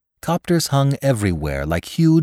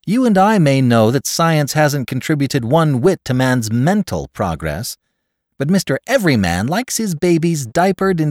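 high, loud, emphatic counsel; confident adviser; 10 semitones higher, 7 dB louder than usual. A narrator's reading. Caller counsels his friend on handling them, confident and emphatic. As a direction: play the assured friend, voice pitched up.

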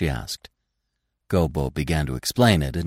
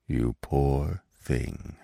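very slow, softened pity; low-key, sorrowful old stag; soft; 3 semitones lower, 5 dB quieter, much slower than usual. A narrator's reading. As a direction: say it softly and sadly. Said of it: "he said softly at last".

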